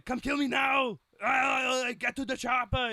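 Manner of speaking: high, loud action-movie Arnold yell; shouted Arnold impression. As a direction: bark it like a movie one-liner, way up top. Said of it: "it's always up here".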